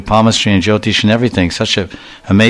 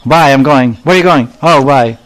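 mock curt goodbye; 4 semitones higher, 3 dB louder than usual. Mocking curt Western goodbye.

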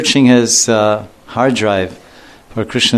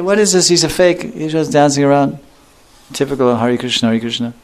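high, mocking scoffing crowd; scornful onlookers; 4 semitones higher than usual. Onlookers scoffing at Haridas dancing, high and contemptuous. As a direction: sneer that he is a fake.

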